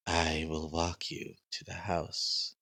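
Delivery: slow, hushed servant voice; soft, measured gatekeeper voice; creepy guard. Acted. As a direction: draw it out softly as an escort. Game master voices the gate guard.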